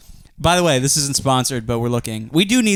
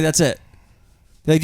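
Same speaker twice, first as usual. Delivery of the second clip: ordinary delivery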